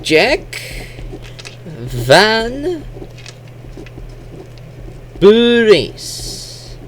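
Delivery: Bures drawn out and high, an extra vowel after the B; Japanese-style pronunciation. Shown as a demonstration. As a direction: say "Jack Van Bures" slowly, high-pitched, as a Japanese speaker would. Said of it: "how the Japanese would say".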